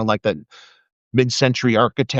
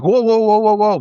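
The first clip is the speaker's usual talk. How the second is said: calling out whoa; 9 semitones higher, 5 dB louder than usual. Retold or imitated. Cop calling out to intruder.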